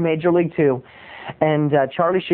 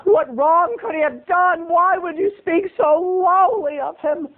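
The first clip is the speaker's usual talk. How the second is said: high, slow mock dismay; imagined shocked listener; 11 semitones higher, slower than usual. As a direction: plead in a high, drawn-out voice. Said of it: "I know what you're saying to yourself".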